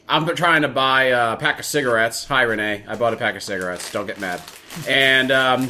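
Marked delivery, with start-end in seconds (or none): none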